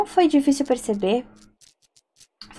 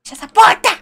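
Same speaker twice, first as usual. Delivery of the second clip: panicked shout; 11 semitones higher, 3 dB louder than usual. Real, not acted. Panicked, shutting the door.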